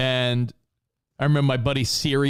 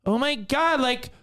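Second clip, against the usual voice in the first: put-on much higher, breathless girly voice; mock excited girlfriend; gushing girl; 9 semitones higher than usual. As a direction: play the smitten girl. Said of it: "some girl comes up and she's like".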